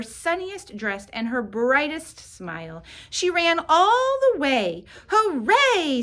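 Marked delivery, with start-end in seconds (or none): 5.1-6.0 high and loud happy cheer; excited cheer. A narrator's reading. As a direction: cheer it out, thrilled. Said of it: "said Chrysanthemum".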